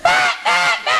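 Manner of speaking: high imitated alarm honk; sudden piercing "bah" bursts; ugly alarm blare. Comic contrasting the real alarm with the nice one, blaring. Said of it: "My alarm goes like".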